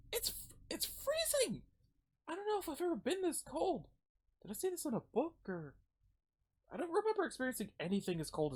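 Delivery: put-on high, bewildered girl voice; young girl character. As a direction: pitch it high, small and amazed.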